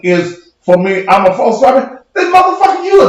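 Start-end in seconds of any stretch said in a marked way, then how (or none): 2.1-3.1 high yelled insult. Real, not acted. Angry rant at a commenter.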